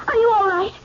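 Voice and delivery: ordinary talk, nothing marked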